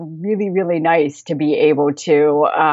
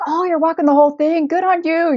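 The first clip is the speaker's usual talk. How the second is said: cheery Scottish local; 11 semitones higher, 3 dB louder than usual. Retold or imitated. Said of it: "they'd be like".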